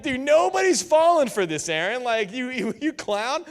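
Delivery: high, loud scoffing at the brother; big brother calling out the little brother's trick; mocking outrage. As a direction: jeer at a sibling's silly trick.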